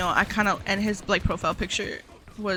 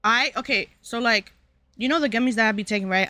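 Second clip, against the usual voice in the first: usual speaking voice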